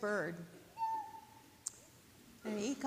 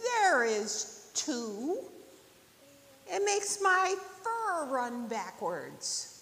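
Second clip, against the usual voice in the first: lightly voiced rabbit, contradicting; slow, higher protest from a rabbit; insistent rabbit; 5 semitones higher, 6 dB louder, slower than usual. Rabbit arguing with turtle about wind, insistent.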